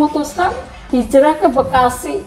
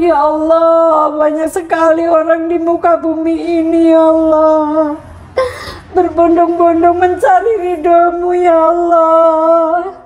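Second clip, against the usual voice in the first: sobbing prayer; 4 semitones higher than usual. Retold or imitated. Mother in front of the television pleading with God, sobbing. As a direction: cry out to God, asking why not me. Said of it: "Saya nangis".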